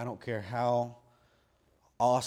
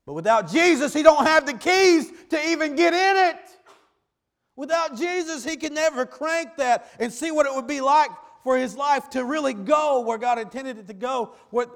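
shouted sermon climb, much higher and louder; full-force preaching; 13 dB louder than usual. Acted. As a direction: preach it hard to the congregation.

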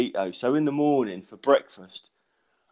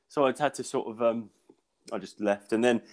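usual speaking voice

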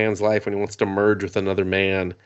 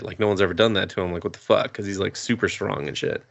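usual speaking voice

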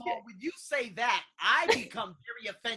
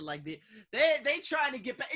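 normal talking voice

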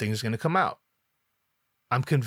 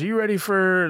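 higher, louder stage-host call; MC hyping the crowd; 8 semitones higher, 6 dB louder than usual. Retold or imitated. MC introducing the performer to the waiting audience, hyped call-out. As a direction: imitate an MC working up a crowd. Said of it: "I think the M C, like, says".

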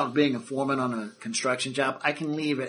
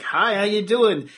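friendly new guy; 6 semitones higher, 4 dB louder, slower than usual. Acted. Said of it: "this new guy is just like".

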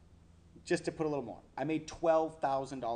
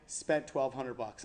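ordinary delivery

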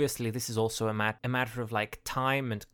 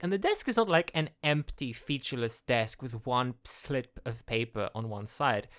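ordinary talk, nothing marked

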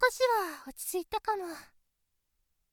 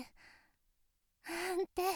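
ordinary talk, nothing marked

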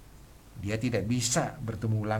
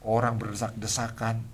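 ordinary talk

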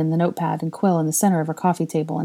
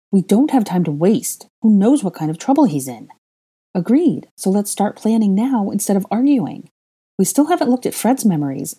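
forceful; 5 semitones higher, 4 dB louder than usual. A narrator's reading. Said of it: "Hermione suggested forcefully".

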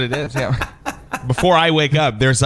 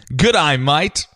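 Australian accent. Shown as a demonstration. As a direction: put on an Aussie accent. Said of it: "What does your Australian accent sound like?".

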